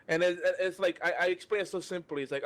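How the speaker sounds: ordinary talk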